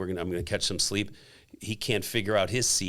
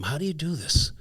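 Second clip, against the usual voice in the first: high, questioning mother's scolding; retold motherly challenge, pitched up; mother's disapproval; 7 semitones higher than usual. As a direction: lightly imitate a baffled, disapproving mom. Imitating his disapproving mother.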